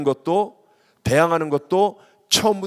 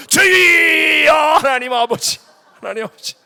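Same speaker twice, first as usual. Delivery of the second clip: huge high, drawn-out cry, then falling away; showy prayer outcry; 13 semitones higher, 9 dB louder, slower than usual. Retold or imitated. Praying louder as he returns from the restroom, showy outcry. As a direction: burst into loud put-on prayer to be noticed. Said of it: "막 기도하다가".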